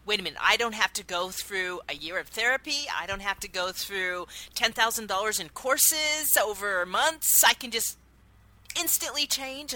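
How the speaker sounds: amazed realization. Retold or imitated. Recalling the moment therapy suddenly felt unnecessary, amazed and excited. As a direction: wait a minute, it can't be this easy. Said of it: "I'm like".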